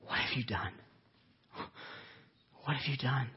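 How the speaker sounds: a grieving God breathing out the question; hushed pained whisper. Acted. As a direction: whisper it, heartbroken, barely voiced. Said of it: "you remember what he says".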